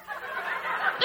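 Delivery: sharp unvoiced gasp of shock; soaked gasp. Teens splashed with mud water, sharp gasp. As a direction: gasp as water hits you. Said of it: "And they're like".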